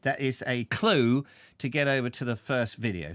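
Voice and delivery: ordinary delivery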